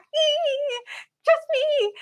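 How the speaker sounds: very high squeaky dolphin chirp. Pretend. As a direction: squeak it out like a friendly little dolphin. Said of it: "That, that's my dolphin voice".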